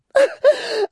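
very high, loud giggling shriek; high girlish titter. Acted. Flora tittering at Clennam, high shriek of laughter. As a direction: burst into a coy little shriek of laughter. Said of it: "with a little scream".

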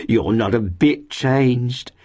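high, very slow landlady gush; effusive, lingering flattery. A narrator's reading. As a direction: draw out the compliment in a high voice. Landlady goes on flattering guest.

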